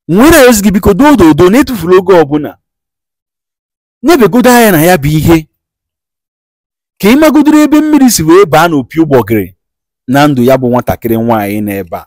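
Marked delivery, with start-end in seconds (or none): 0.1-9.6 high, loud, fast preacher's call; loud, urgent preaching to the caller; fervent preaching. Acted. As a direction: drive the plea home like a revival preacher. Host preaching at full force.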